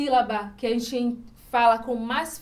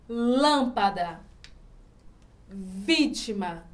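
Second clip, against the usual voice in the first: "Lâmpada", "vítima" slowly, LÂM and VÍ held; stress on first syllable; 4 semitones lower, much slower than usual. Shown as a demonstration. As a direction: stretch LÂM and VÍ, word by word. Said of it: "A sílaba mais forte está na antepenúltima". ("Lâmpada, vítima" devagar, alongando LÂM e VÍ)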